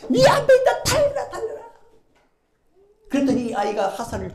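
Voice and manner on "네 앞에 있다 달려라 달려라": high, loud urging cry; urgent shout. Acted. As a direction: shout him onward. Jonathan shouting to run.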